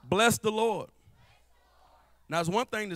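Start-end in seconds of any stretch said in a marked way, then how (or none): 0.0-0.9 loud, ringing cry of hey soul; shouted call to the soul. Shown as a demonstration. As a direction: call out to your own soul.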